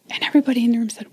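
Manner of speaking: ordinary talk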